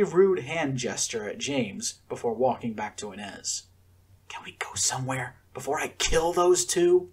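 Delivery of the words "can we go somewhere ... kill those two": angry whisper. A narrator's reading. Akira to Inez after the fight, fuming whisper.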